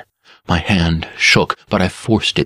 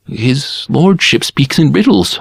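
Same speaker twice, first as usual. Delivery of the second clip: higher humble murmur; 8 semitones higher than usual. A narrator's reading. Barber deflects the lord's accusation, higher murmur. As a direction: murmur it up high, deferential. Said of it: "I murmured".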